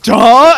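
loud, high mock outcry; sudden full-voiced exclamation; crew's startled cry. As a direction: blurt one big exasperated cry. The whole crew looks back at you and goes like.

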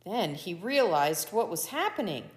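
usual speaking voice

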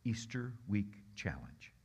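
slow breathy whisper. Real, not acted. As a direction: whisper it as a gentle challenge. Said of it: "May I ask you".

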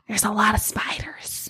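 spooky whisper. Acted. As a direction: hush it like a creepy secret. GM spooky aside.

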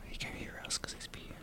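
very soft, voiceless whisper; conspiratorial whisper. Pretend. Friends gossiping while he pees, whispering. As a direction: whisper it behind his back. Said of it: "Let's just whisper about him".

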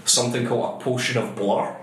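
ordinary delivery

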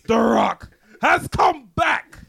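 slow, loud, high announcer yell; hype shout. Acted. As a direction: bellow it like a ring announcer. Hyping the return, shouted.

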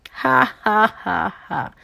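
acted spaced-out "ha ha"; hammed-up laugh fading away; tiger's laugh. A narrator's reading. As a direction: laugh it out slowly, then let it drop. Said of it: "rió tío Tigre".

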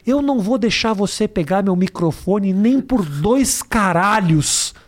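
ordinary talk

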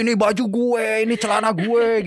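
high, eager shopper squabbling over clothes; mock shopper grabbing clothes. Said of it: "Mas Argun kayak".

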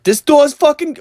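high, loud threat; mock enraged shriek; imitated screaming. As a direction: mimic a furious woman yelling. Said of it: "I remember hearing her say".

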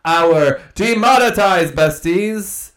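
high, loud, slow announcer voice; announcer shout-out. Acted. Host thanks Patreon tiers, high slow announcer call. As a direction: hype each name like a game show host. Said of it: "thanking supporters in a showy voice".

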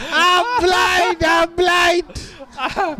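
high, drawn-out mock wail; imitated screaming. Recalling somebody screaming after a prank, mock screaming. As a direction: mimic a victim's scream, stretched and high. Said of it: "that memory of somebody screaming".

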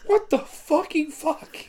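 high, slow, wheezing through laughter; helpless laughter. Real, not acted. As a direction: swear while losing it laughing. Helpless laughter after the blow-up.

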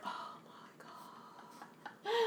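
slow, breathless whisper; whispered dread. Retold or imitated. Onstage during the play in a slow dread whisper. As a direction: whisper it like you're dying inside. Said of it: "I'm like".

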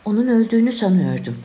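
plain reading voice

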